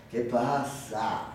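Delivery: low, slow, quiet question; manager's voice. Acted. Manager looking up from his report, low slow drawl. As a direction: ask it slowly, deep and soft. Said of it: "dijo el gerente".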